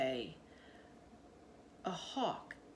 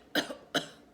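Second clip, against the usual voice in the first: one dry throat-clearing cough; 6 dB louder than usual. A narrator's reading. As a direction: clear the throat before a story. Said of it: "She coughed".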